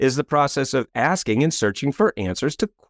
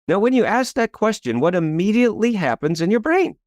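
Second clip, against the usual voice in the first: normal talking voice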